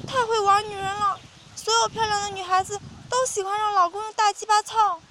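high, coy female character voice; coquettish woman. A narrator's reading. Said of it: "娇滴滴令男人听着舒爽".

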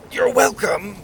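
high, strained wheeze; wheezing. A narrator's reading. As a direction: squeeze it out as if being crushed. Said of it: "Pockets wheezed".

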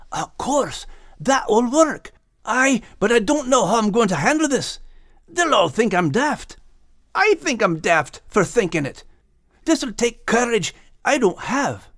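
high Scottish dog voice, excited then doubtful; Max. A narrator's reading. Max jumping up with an idea, high excited then unsure. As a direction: burst with the idea, then worry.

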